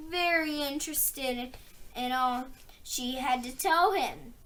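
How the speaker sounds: plain reading voice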